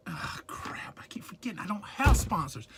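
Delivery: sheepish whispered aside. Real, not acted. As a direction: whisper the self-correction. Catching himself, aside to camera.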